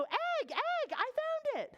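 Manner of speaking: high, delighted shout of discovery; excited call. Shown as a demonstration. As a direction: cry it out like finding treasure. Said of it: "you have to go".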